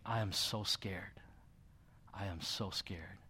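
soft, slow, trembling-edged fear; mild imitation of a scared, crying wife; retold tearful plea. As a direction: quietly relay a frightened wife's words. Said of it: "and she was crying".

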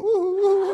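high, loud hummed ooh in laughter; laughing high, loud mock ooh; mock moaning ooh. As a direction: imitate the old man's mumbling prayer sounds. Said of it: "I think he's going".

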